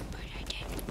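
soft whispered aside. Real, not acted. Gamer whispering after almost dying, whispered aside. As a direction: breathe it to yourself.

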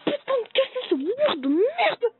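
high, loud furious whining rant; raging gamer. Acted. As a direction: rage at the game, near tears. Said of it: "Le mec qui rage quand il perd".

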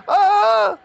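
shrill high scream; terrified scream. Real, not acted. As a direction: scream in real panic. Caught by monster.

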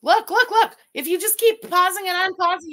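excited. Real, not acted. Pointing out a paused video frame, loud and excited. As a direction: grab their attention, loud and high.

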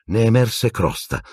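plain reading voice

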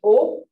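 loud, short, pure oh; strong O sound. Shown as a demonstration. Teacher asking learners to hear it, forceful oh. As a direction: punch oh without an English glide. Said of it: "Hear that?".

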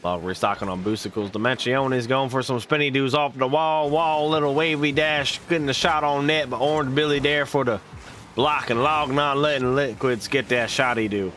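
lively esports play-by-play, rising on big plays; play-by-play casting. Acted. Streamer casting a match to viewers, lively play-by-play. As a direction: call the match like a hyped caster.

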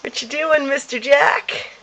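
high sing-song pet talk. Real, not acted. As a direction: coo at the dog. Talking to the dog.

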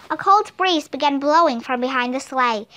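ordinary delivery, nothing marked

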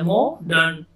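मॉडर्न broken into "mo ... dern"; syllable by syllable. Shown as a demonstration. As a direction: pause between mo and dern in मॉडर्न. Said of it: "अलग अलग जोड़ के बनाते हैं". (मॉडर्न के दोनों हिस्से अलग-अलग)

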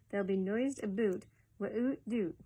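slow, careful Scots with "wi'oot doot"; deliberate Scots dialect delivery; Scots accent. Acted. Reader redoes the puzzling Scots line.

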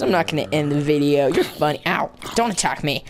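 normal talking voice

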